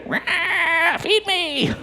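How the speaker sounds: whimper-cry it like a hungry infant; wailing baby. Acted. Voicing a crying demanding baby.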